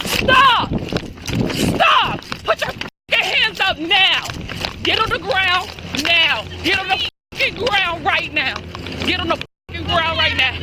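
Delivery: yelling commands at full voice; shouted surrender demands. Real, not acted. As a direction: bark orders to get down. Officer chasing, ordering suspect down.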